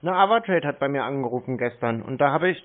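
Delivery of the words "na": ordinary talk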